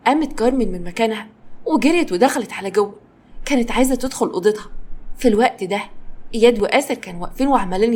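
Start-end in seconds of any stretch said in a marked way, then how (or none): none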